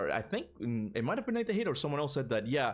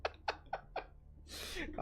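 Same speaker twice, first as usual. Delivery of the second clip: laughing; 5 dB quieter than usual. Real, not acted. Laughing about Chris looking high.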